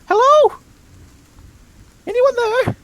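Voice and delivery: very high, odd little creature; squeaky creature voice. Acted. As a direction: squeak every line way up high. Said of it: "Sounds like a cat getting eaten".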